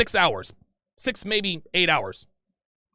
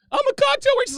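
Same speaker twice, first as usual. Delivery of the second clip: much higher mock girlfriend voice, defensive; imitating girlfriend; 3 dB louder than usual. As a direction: pitch it way up as her protesting.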